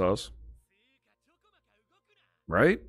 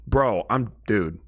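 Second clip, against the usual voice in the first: normal talking voice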